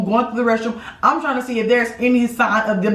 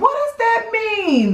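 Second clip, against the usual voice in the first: indignant; 12 semitones higher, slower than usual. Real, not acted. Couple bickering.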